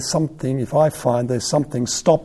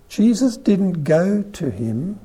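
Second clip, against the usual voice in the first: usual speaking voice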